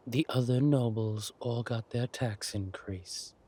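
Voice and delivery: ordinary talk, nothing marked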